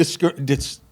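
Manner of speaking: stumbling over the word; stammering, restarting the word; stuttering. Real, not acted. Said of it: "now I'm stuttering".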